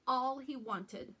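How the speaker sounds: ordinary delivery, nothing marked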